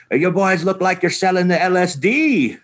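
high, put-on Irish Boston cop voice; mock Boston cop. Narrator quotes the Boston cop stopping them, mock Irish cop voice.

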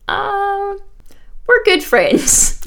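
high, slow coy giggle; giggly. Retold or imitated. Friend retelling a celebrity's coy dodge, high and giggly. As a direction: play it coy and giggly, dragging it out. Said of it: "she's, like, all giggly".

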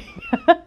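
high burst of laughter; self-mocking laugh. Real, not acted. Vlogger mocking her own spelling, bright laugh. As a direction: laugh at yourself, amused.

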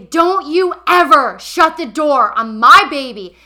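put-on furious shout, protecting the baby; retold yell. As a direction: yell it at him through the door. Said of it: "and I say".

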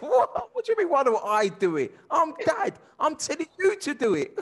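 high, laughing disbelief; mock outrage. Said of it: "I said".

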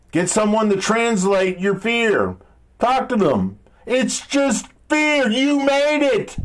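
usual speaking voice

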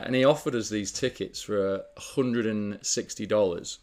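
ordinary delivery

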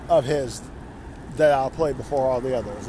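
ordinary talk

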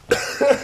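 short sharp cough after choking; real cough clearing the throat; leftover choking cough. Real, not acted. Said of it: "Engasguei-me com água".

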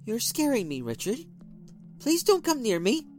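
high, frightened attempt at calm; steady the voice, scared; wife trying to calm threatening husband; forced calm. A narrator's reading. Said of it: "in the calmest voice she could muster".

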